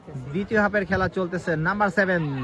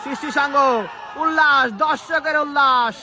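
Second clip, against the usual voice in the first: excited commentary; 7 semitones higher, 5 dB louder, slower than usual. Real, not acted. Crowd cheers the attack.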